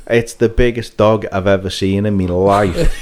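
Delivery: ordinary talk, nothing marked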